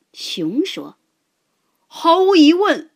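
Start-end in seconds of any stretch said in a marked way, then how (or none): 1.9-2.9 bear agreeing. A narrator's reading.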